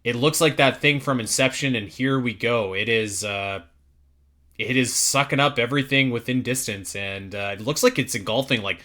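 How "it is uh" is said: usual speaking voice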